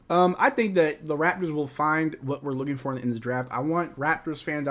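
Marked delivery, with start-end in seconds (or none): none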